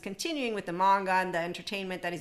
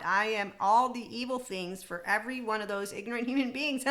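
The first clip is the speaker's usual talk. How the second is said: theatrical, sarcastic lament; mock dismay; 2 semitones higher, 4 dB louder than usual. Parroting judgmental people's labels for her, mock dismay. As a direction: play up the scandal with exaggerated horror. Mocks how others judge her.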